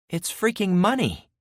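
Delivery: high, astonished and thrilled; excited exclamation. Acted. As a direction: blurt it out in disbelief. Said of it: "Penny exclaimed".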